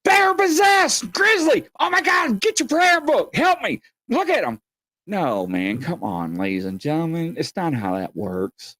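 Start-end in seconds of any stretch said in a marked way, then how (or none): 0.0-4.6 high, loud hysterical shrieking; hammed-up terrified yelling, much higher and louder; mock panic. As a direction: freak out like a drinker sure they're possessed. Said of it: "You're gonna go".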